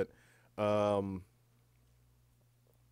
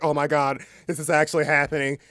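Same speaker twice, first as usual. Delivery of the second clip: loud, high, hammed-up awe; mock amazed excitement; 7 semitones higher, 12 dB louder than usual. Flown across the world by a hero, mock amazed. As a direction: exclaim it like a thrilled kid. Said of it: "you're like".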